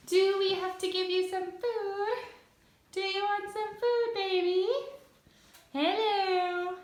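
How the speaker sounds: high cooing pet voice. Real, not acted. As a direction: coo sweetly to the cat. Cooing to her cat.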